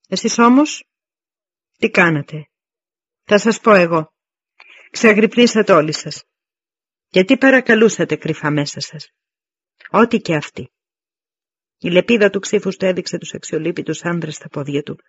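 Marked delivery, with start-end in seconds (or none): 0.1-10.7 pointed challenge with forceful, punched openings; confronting the troops. Acted. Commander turns the accusation on his men, confronting. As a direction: put the soldiers on the spot, firmly.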